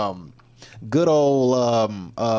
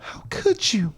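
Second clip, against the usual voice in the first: high-pitched, hammed-up wounded plea; mock hurt; 8 semitones higher, slower than usual. Streamer pleading with censors, mock hurt. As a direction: play scandalized and betrayed. Acts out own hurt reaction to ban.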